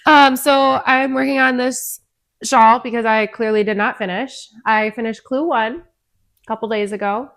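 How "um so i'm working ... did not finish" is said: talking around a knitting needle; words muffled by a needle held in teeth; needle in mouth. Real, not acted. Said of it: "I've got a needle in my mouth most of the time".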